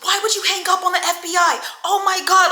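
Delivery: high, loud, rushed panic; imitated freaking out. Woman berates man for hanging up, imitated freak-out. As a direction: act out her frantic outburst. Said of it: "she's freaking out".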